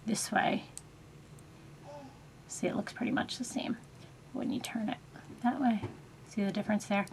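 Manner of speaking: soft whispered aside; whispering. Real, not acted. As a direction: whisper it while turning the brush. Quiet aside while turning brush.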